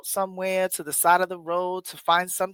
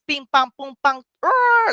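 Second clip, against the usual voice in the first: storm noises; 8 semitones higher, 7 dB louder than usual. Retold or imitated. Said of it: "all I heard was".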